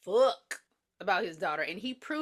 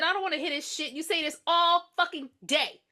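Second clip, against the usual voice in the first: higher, louder and building angry; annoyed yelling; 7 semitones higher, 4 dB louder than usual. Retold or imitated. Patients yelling at the loud woman. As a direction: yell at her to shut up. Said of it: "they said".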